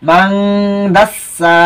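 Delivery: মাংদাস সা with a long hummed "ng" and a lengthened সা; ghunnah and long vowel. Shown as a demonstration. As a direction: recite মাংদাস সা slowly, stretching মাং and সা. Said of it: "এক আলিফ পরিমাণ লম্বা হবে".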